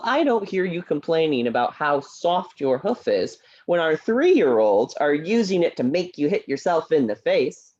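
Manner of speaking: ordinary talk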